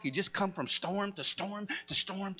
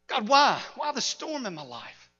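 anguished question, loud at the start; acted protest to God; 6 semitones higher, 5 dB louder than usual. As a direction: cry out to God, then drop back.

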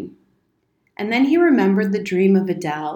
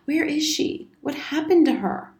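put-on high, urgent boy's questions; raised pitch without raised volume; urgent questioning; 8 semitones higher than usual. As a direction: press for an answer, pitch lifted. Boy demands news of sister.

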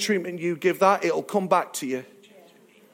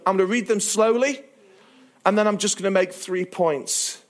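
ordinary delivery

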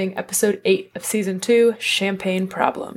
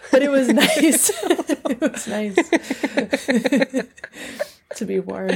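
laughing; 3 semitones higher than usual. Real, not acted. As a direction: laugh through the words.

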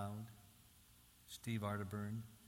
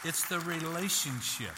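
shouted out high and very loud; emphatic shout; 7 semitones higher, 10 dB louder than usual. Real, not acted. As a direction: drive the point home at full volume. Preacher drives the main point home.

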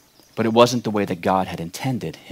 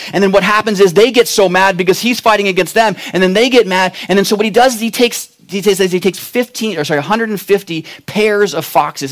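preaching at full force; 8 semitones higher, 4 dB louder than usual. Acted.

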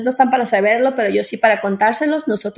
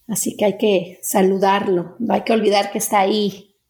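guided meditation. Acted. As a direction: guide the breathing calmly. Leads a breathing meditation.

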